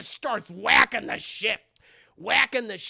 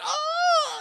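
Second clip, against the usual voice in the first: very high, loud "Oh!"; mock shriek of alarm; alarmed cry; 6 dB louder than usual. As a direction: cry out in shock at the wheel. Driver sees child in road.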